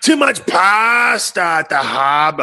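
slow, loud hammed-up Boston accent; mock Boston accent. Host teases Tim about Boston pasta in Boston accent. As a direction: bellow it like a Southie local. Mocking Bruins pasta joke.

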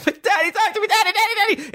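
very high hammed-up plea to Daddy; mock desperate screaming. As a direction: cry out like a panicking child in a dream, played for laughs. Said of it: "And I wake screaming".

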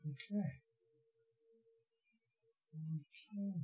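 very soft, high-pitched murmur; hushed old lady. Acted. Old lady reacts to her vision, hushed. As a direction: say it tiny and guarded, hardly voiced.

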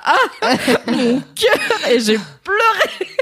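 laughing while telling how it moved her; laughing through emotion. Real, not acted. Moved retelling a sad story.

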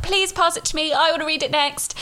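high, eager begging friend; mock pleading plea to borrow. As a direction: beg for the book in a friend's voice.

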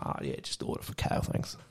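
muttered sarcastic whisper; whispered aside. Retold or imitated. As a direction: say it under your breath so he can't hear. Sarcastic aside under his breath.

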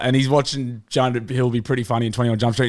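ordinary delivery

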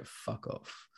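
very soft, voiceless mutter; dismissive dog. Acted. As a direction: mutter it under your breath, as an unbothered dog. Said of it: "he'll just look at you and go".

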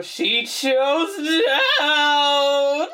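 sung-out mock cry; mock dramatic shout. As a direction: belt it out high, stretching the last word. Quoting chorus of characters in film.

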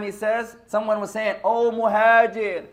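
ordinary talk, nothing marked

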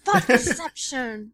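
slow, drawn-out punchline; dramatic. Pretend. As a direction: stretch the word for effect.

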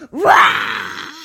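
loud play roar to scare; tiger roar. Acted. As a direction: roar suddenly like a pretend tiger. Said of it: "the tiger jumps up and scares them".

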